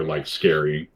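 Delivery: normal talking voice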